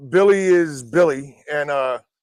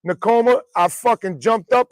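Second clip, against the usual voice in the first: ordinary talk, nothing marked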